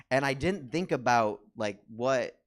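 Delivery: ordinary talk, nothing marked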